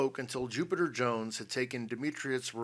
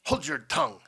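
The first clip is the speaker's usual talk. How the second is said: loud, high, sharp command; angry snap; 8 dB louder than usual. A narrator's reading. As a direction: shut him up with a snap. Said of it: "snapped the general".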